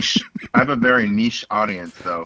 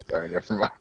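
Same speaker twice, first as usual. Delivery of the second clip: ordinary talk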